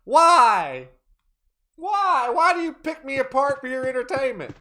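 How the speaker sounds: exaggerated, exasperated outcry; mock anguished wail. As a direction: wail as if terribly picked on. Mock protest at being teased.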